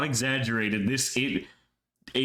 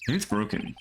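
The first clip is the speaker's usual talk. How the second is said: usual speaking voice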